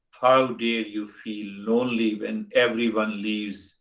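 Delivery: ordinary delivery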